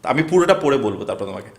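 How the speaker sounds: usual speaking voice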